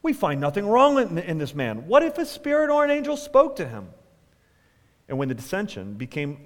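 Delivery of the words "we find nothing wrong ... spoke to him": lightly argumentative, pitch leaping up at the end; sharp contending. A narrator's reading. Pharisees' scribes arguing for Paul in the council, mildly sharp. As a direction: read it as a mildly heated objection. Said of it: "stood up and contended sharply".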